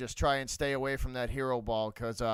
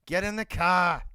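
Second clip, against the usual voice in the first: louder, higher hammed-up Boston line, R dropped; Boston accent; 4 semitones higher, 5 dB louder than usual. Shown as a demonstration. Streamer joking about no R's, Boston accent. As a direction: end on a big R-less car, Boston style. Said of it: "We don't use R's on this channel".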